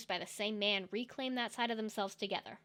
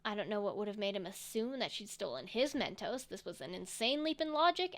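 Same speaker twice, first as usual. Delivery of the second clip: ordinary delivery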